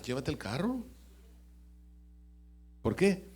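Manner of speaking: ordinary delivery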